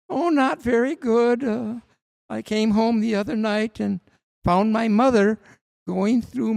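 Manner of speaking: high, slow sing-songy voice; lilting, wide-ranging comic whine; Emo Phillips voice. Retold or imitated. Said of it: "He talks in the sing-songy voice like this".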